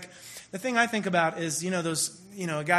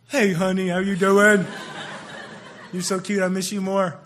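loud, gushing mock sweetness; all-out girlfriend voice; 5 dB louder than usual. A boyfriend answers his girlfriend's call, loud mock gushing. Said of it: "he just needs to just go".

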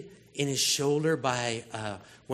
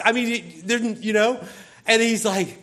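ordinary talk

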